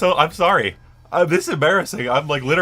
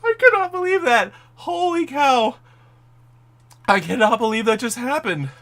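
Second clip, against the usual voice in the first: real excited disbelief; 9 semitones higher than usual. Real, not acted. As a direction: still reeling from the news. Fan reacting to dream announcement.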